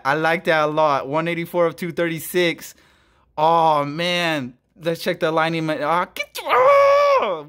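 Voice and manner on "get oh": surprised yelp. Real, not acted. Sees misaligned card.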